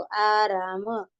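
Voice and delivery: slow आराम with long aa vowels; careful model reading. Shown as a demonstration. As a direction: draw out आराम, ending on a separate ma. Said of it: "देखो".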